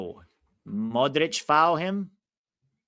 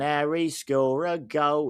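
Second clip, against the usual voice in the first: normal talking voice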